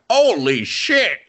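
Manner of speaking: over-the-top bad anime English. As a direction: belt it slowly in hammy dubbed English. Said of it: "they do English, and it's really bad".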